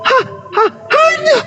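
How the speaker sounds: stammer, then very high long wails; hammed-up shrieks of ghostly terror; mock terrified screaming. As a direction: choke on the word, then scream it out. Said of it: "lalu mereka berteriak-teriak".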